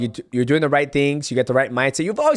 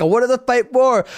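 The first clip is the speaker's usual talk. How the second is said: mock quote; 8 semitones higher, 3 dB louder than usual. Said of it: "he was like".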